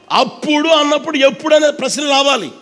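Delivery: ordinary talk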